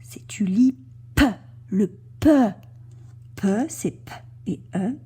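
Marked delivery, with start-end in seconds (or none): 1.2-2.3 "P" popped out as a separate sound; final-sound demonstration. Shown as a demonstration. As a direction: say "P" as an isolated, emphatic consonant sound. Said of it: "la dernière syllabe qu'on entend".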